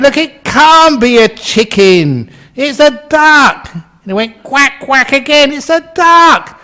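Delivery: high, loud exasperated insistence; hammed-up marital squabble; heated argument. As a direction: argue back at full pitch. Acted husband's escalating quarrel.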